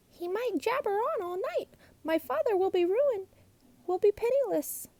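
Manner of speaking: put-on high, shaky-fearful girl; frightened girl. A narrator's reading. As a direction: fret in a scared rush. Sister frightened.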